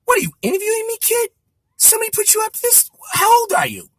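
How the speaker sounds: very high impersonation voice, firing questions; Rickles. Retold or imitated. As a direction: imitate a suspicious comic grilling a kid. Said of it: "Rickles looks at me and he goes".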